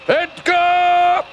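excited cheering. Real, not acted. Cheering on a magic helper.

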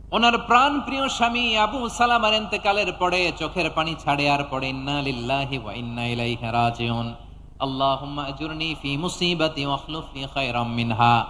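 sinking low, soft melodic lament; mournful intoning. Retold or imitated. Preacher telling of a weeping widow praying, mournful low intoning. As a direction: let the voice fall into a hushed chant.

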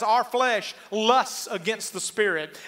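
usual speaking voice

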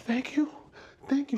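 high-pitched, mock-tearful gratitude; imitated tearful thanks. Overwhelmed fan thanks the singer for a keepsake, high and tearful. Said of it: "She starts crying".

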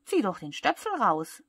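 loud, lively suggestion; urging advice. A narrator's reading. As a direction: call out the obvious fix. Cloud calls out advice.

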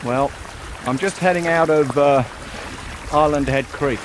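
ordinary talk, nothing marked

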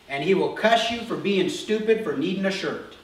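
ordinary delivery

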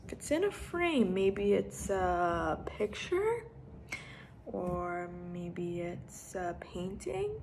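slow, drawn-out guessing; wondering aloud. Shown as a demonstration. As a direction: ponder it slowly for a child. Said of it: "Let's make some predictions".

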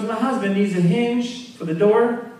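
high, lightly voiced woman; wife's voice. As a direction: play the sweet Southern wife. Wife speaks to store clerk.

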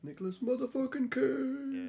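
high, slow hyped-up announcement; hype shout. Acted. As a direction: announce him like a wrestler. Hyping Nicolas Cage.

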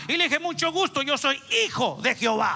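loud, high preaching; loud triumphant pulpit voice; preaching climax. Real, not acted. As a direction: build it up loud.

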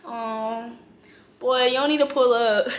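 usual speaking voice

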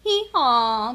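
loud, drawn-out hee-haw; donkey bray. A narrator's reading. Sleeping donkey braying in the barnyard, loud hee-haw. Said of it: "brayed the donkey".

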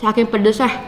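panting through open mouth; teary, fanning mouth after chili; breathless from spicy heat. Real, not acted. Seen 1: Sips water, eyes shut, mouth open, fanning; chewing not clearly seen.

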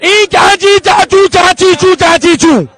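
loud, slow mock gibberish with laughs; hammed-up fake Pakistani language. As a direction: shout fake foreign babble, mocking. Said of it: "फिर मेरी पाकिस्तानी भाषा सुनो".

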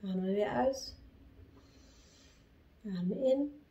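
low, soft meditation voice; hushed, unhurried guiding between chants; guided breathing. Real, not acted.

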